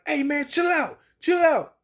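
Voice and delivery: high, pressing chill out; urgent calming. Retold or imitated. Dent tells Gangster to calm down, urgent high call.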